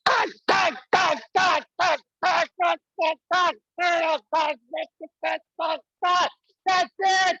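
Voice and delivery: high, stammering rapid-fire that's; breathless excitement. Real, not acted. Comic sputtering over the crowd's cannon noise, high and excited. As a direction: sputter with overwhelmed glee.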